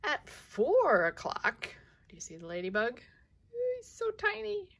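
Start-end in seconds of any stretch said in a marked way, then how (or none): 3.5-4.7 tiny squeaky voice. Real, not acted.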